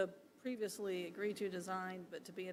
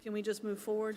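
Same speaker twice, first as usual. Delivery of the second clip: louder so staff can hear; repeated louder; 7 dB louder than usual. Real, not acted. Commissioner repeating question to staff who missed it, louder. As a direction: say it again, louder and clearer.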